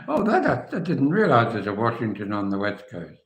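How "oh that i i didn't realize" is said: surprised. Real, not acted. Learns of West Coast Washington.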